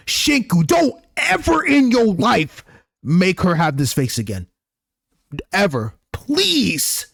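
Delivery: high, slow, pleading scold. Acted. Player scolding Shinku for scaring her, pleading. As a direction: beg him never to do it, slowly.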